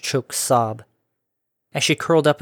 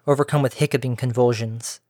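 ordinary delivery

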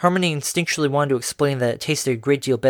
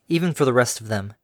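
plain reading voice

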